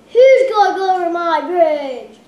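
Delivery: loud troll challenge; booming troll. Acted. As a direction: bellow it like a troll.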